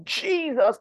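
a retold cry for help; mock scream. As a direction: call the name out high as in the dream. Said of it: "I will scream".

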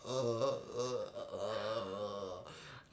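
laughing lowest note, barely voiced; extremely deep bass voice. Shown as a demonstration. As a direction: croak out the lowest sound you can. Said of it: "声になってない".

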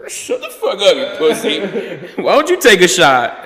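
put-on high, laughing drunk jeer; mock drunk taunt. Drunk friend mocking the warning, high and laughing. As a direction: taunt him while cracking up. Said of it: "I'm like".

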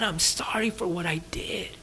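whispered apology, as if through tears; tearful whisper. Retold or imitated. A person in tears whispering sorry to someone they hurt. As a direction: breathe the apology out, barely voiced, near tears. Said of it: "that you're sorrying and you're crying".

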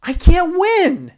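frustrated guy wailing at the girl, mock exasperated. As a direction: cry out that you can't win. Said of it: "And you're like".

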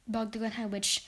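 ordinary delivery

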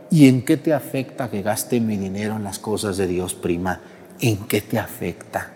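low, quiet pointed comeback; indignant cousin retort. Acted. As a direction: put the gossip in her place. (prima indignada que responde en voz baja)

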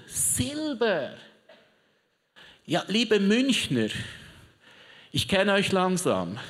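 ordinary talk

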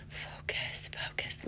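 very soft whisper to herself; hushed, under the breath; whispered self-reminder. Real, not acted. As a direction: whisper it like a private mantra.

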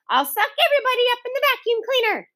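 put-on high, fast gleeful boy threat; playful threat. A narrator's reading. Boy chasing everyone with the roaring vacuum cleaner. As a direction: tease them all at speed.

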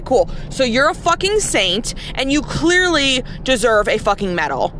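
usual speaking voice

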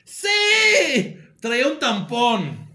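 high, loud cry on the first word; hammed-up exclamation, then drawn out slowly; dramatic reveal. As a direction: blurt out the shocking punchline. Punchline of read confession, exclaimed.

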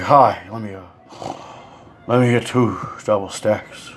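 imitating a badly winded customer; low, labored ordering between long heavy breaths; heavy breathing. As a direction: puff and wheeze between each item. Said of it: "he's Darth Vader breathing without the mask".

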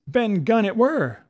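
high, loud, relieved cry; spring up and shout it; pirate shouting from his knees; excited cry. A narrator's reading. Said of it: "cried Morgan".

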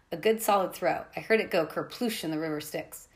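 usual speaking voice

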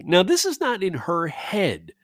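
usual speaking voice

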